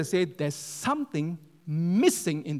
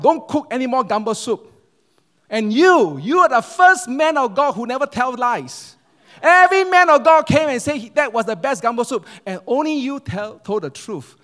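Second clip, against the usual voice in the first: loud, raised-pitch acted tirade; table-banging outburst; 8 semitones higher, 11 dB louder than usual. Husband to wife and honest pastor, loud outburst. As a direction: thunder it as the fed-up husband. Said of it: "the husband banged the table".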